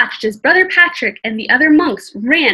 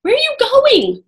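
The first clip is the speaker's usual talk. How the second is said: loud, high alarmed question; crying out; 13 semitones higher, 6 dB louder than usual. A narrator's reading. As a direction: yell after someone running off. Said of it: "cried Annie".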